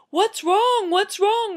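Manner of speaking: high, loud, urgent question; alarmed parents. Acted. Parents rushing to wailing daughter, alarmed. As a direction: call it out in mock panic.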